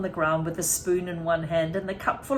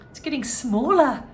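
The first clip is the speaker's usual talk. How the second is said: put-on alarmed boy; 5 semitones higher, 6 dB louder than usual. A narrator's reading. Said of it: "said George".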